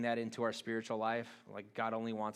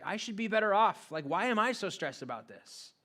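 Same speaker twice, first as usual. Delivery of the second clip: high, loud exasperated complaint; frustrated inner voice; 7 semitones higher, 6 dB louder than usual. Retold or imitated. Churchgoer venting to himself, louder and exasperated. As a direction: vent like a stressed believer who feels cheated. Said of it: "And you're like".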